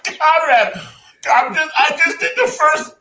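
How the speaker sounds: high laugh breaking through the words; can barely talk for laughing; laughing hard. Real, not acted. Cracking up at co-host.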